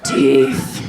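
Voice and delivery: slow eerie whisper; creepy whisper. Retold or imitated. Toddler whispering a joke close to her face, eerie whisper. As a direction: breathe the word like a creepy child. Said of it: "he whispered".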